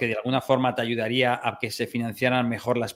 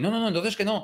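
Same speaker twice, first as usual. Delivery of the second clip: put-on young person refusing; 8 semitones higher than usual.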